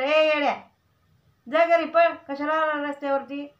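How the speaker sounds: a half-playful yell to go home; mock scolding neighbour. Mother retelling to her son how a neighbour scolded him, mock yell. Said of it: "मधी म्हणले असेल".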